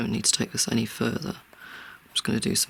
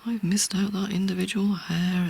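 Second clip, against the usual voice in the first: high-pitched mock panic over small details; mock perfectionist fretting, pitched up; freaking out; 6 semitones higher than usual. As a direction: imitate your old anxious self fretting, voice raised in pitch. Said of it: "I'd be freaking out".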